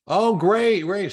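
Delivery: put-on bright, high "Oh, great" to an old friend; excited greeting. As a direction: sound delighted to hear from them. Friend's reaction in acted call.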